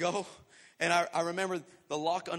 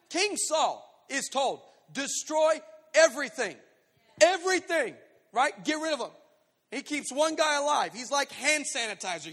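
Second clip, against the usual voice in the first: loud, raised, rhythmic sermon delivery; forceful preaching; 4 semitones higher, 8 dB louder than usual. Real, not acted. Preacher urging congregation to full obedience, forceful. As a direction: drive each phrase home to the congregation.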